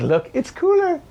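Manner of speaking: squeaky high mock Trump voice; mocking Trump sneering at climate scientists in a shrill put-on voice; Trump impression. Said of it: "he will be in that moment then being like".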